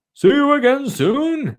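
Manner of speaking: high, sing-out farewell; calling out. Acted. Mister Thompson waves the children off, high calling voice. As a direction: call it out high as a goodbye. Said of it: "he called".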